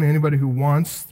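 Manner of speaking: ordinary delivery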